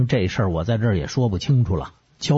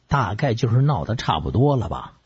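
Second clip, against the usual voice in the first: ordinary delivery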